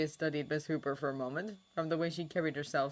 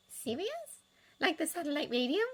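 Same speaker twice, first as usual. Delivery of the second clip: put-on higher, lighter voice for a shy young woman; Miss Hooper voice; 12 semitones higher than usual. A narrator's reading. Miss Hooper to Sirius, pitched up and hesitant. Molly's voice in story.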